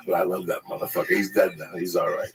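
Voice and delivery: ordinary talk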